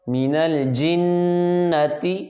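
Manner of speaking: من الجنة with the doubled noon of الجنة hummed through the nose; nasal ghunna on noon. Shown as a demonstration. Teacher demonstrating ghunna to students, slow nasal hum. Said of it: "نون پہ غنہ ہوگا".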